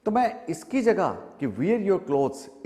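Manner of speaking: ordinary talk, nothing marked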